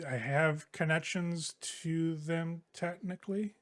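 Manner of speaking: slow, halting and hesitant; uncomfortable. Acted. Yona, uneasy, admits to the roommates she has Tiger Claw ties, slow halting. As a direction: pick each word reluctantly, slowly. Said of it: "she looks really uncomfortable".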